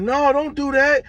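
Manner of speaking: high, slow, loud pleading; mock high-pitched plea. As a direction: beg him not to do it in a high voice. Worker pleads, retold.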